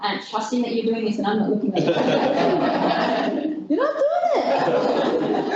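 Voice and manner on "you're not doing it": high, slow shout broken by laughter; laughing shout. Real, not acted. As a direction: cry out through laughter at the audience. Audience fails a body test.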